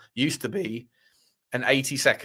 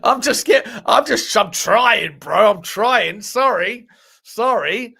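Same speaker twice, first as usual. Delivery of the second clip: mock protest; 7 semitones higher, 7 dB louder, much slower than usual. Playfully protesting at accent criticism.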